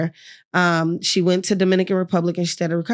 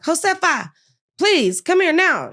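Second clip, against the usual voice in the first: high, raised call to the nurse; 10 semitones higher than usual. Retold or imitated. Patient calling her nurse over, high insistent call. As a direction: summon her sharply, voice pitched up. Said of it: "I finally was like".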